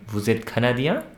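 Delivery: CANADIEN lifted sharply at the end, as a question; questioning intonation. Shown as a demonstration. French teacher asking if you are Canadian, questioning rise on Canadien. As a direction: raise the voice on Canadien to ask. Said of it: "It's a question, actually".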